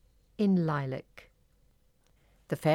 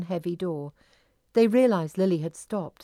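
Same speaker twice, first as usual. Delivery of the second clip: ordinary delivery, nothing marked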